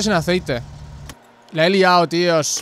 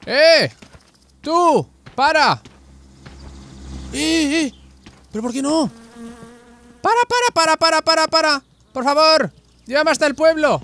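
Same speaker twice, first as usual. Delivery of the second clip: flag down the car, desperate; shouting to stop; 9 semitones higher than usual. Pretend. Stranded player yelling at cars to stop, desperate shout.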